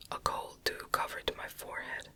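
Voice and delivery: usual speaking voice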